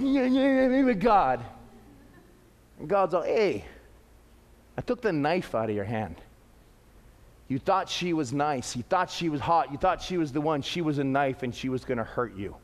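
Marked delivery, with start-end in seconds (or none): 0.0-1.4 lightly whining imitation of a protest; hint of a mock tantrum; mock toddler fussing. Mimics people fussing at God like toddlers. 3.2-12.6 low, soft reassurance; gentle fatherly God. Retold or imitated. Voices God gently explaining to a child.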